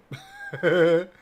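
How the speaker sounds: sudden real laugh. Real, not acted. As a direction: burst out laughing. Laughs at own question.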